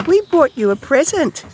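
very high, squeaky mouse voice; little mouse. A narrator's reading. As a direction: pipe it up high like a tiny mouse. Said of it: "said Mouse".

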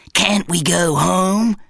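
lightly voiced whining bum; a mild moan to go home; whiny bum. A narrator's reading. Talking bum whining to Zach, lightly voiced. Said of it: "whined his bum".

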